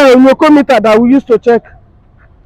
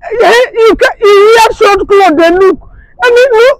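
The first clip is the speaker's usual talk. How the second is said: crying shout; 7 semitones higher than usual. Acted. Husband sobbing, shouting.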